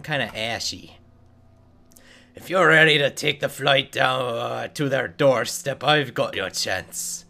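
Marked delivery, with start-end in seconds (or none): 2.3-7.2 dwarf NPC voice. Acted. Quest giver dwarf praising the player, lightly voiced.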